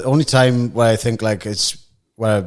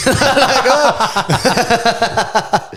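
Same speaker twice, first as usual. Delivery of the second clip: laughing; 11 semitones higher than usual. Retold or imitated. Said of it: "laughing retelling".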